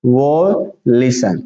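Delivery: very slow, stretched "violation"; syllable by syllable. Shown as a demonstration. Teacher repeating for students, slowly broken up. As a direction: split "violation" into long separate parts. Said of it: "क्या पढ़ेंगे".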